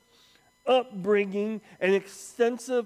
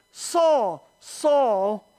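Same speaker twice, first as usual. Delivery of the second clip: loud, high call, pausing between words; booming divine summons, easing at the end; voice from heaven; 4 semitones higher, 5 dB louder, much slower than usual. Acted. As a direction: call out the name commandingly, then question. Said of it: "and a voice says".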